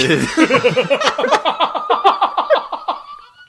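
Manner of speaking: loud, high, long cackle of laughter; big laughter. Real, not acted. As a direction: crack up helplessly. Cracks up at own joke.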